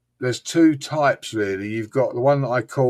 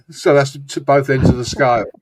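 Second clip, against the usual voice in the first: normal talking voice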